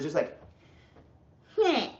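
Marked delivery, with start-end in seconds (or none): 1.6-1.9 high mocking whine; a pouty high-pitched hmm; whiny hum. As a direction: mimic a sulky whiny hum. Mocking his own whiny past self.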